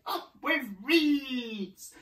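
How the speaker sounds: high, long-drawn duckbill hoot; high, very slow hooted chant. A narrator's reading. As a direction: hoot it out and hold the last word. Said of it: "And they hooted".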